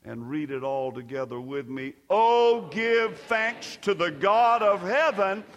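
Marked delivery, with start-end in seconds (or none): 2.1-5.4 loud, drawn-out lead into the final verse; rousing scripture proclamation. Real, not acted. Preacher calls all to read together, proclaiming loudly. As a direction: lift the whole congregation into thanksgiving.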